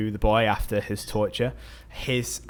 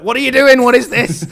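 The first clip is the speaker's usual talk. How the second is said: much higher and louder, a caricatured mum's shriek; shrill mock mother; 13 semitones higher, 14 dB louder than usual. Carmen scolding her daughter, hammed-up shrieking mother. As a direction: squawk it like a scandalised mother. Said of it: "And then Carmen's like".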